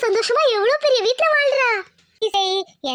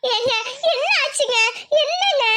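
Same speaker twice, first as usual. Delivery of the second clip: higher, breaking into a sob; crying plea; 3 semitones higher than usual. Acted. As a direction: beg him through tears. Wife pleads, crying. (அழுகையுடன் கேட்டல்)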